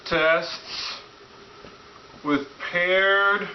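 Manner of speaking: high, very slow drawn-out host voice; slow nerdy host. Acted. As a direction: stretch every word like a dorky host. Retake of intro, drawn out.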